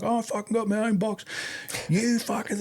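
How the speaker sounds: high mock yell between two RSMs; retold yelling. Two RSMs yelling across the hall, high mock yell. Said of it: "yelling at each other from a distance".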